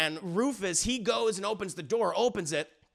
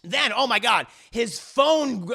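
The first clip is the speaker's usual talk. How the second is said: normal talking voice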